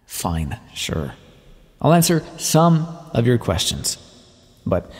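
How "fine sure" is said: low, softer reluctant agreement; grudging consent. Acted. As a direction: give in grudgingly, voice dropped low. Reluctantly gives in to questions.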